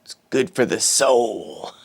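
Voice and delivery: ordinary talk, nothing marked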